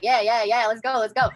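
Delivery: loud, eager let's-go chant; mild hype-up shout; hyped self-cheer. Retold or imitated. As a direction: pump yourself up like a coach. Hyping herself through contractions.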